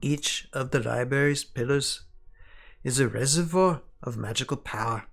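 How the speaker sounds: attempted English accent, measured and deliberate with weighty pauses; elfish English accent. Sanlin writing to Shiori of lost knowledge, in an elfish English accent. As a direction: read it as an elf with an imperfect English accent. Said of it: "sounding kind of elfish, but I can't do a good English accent".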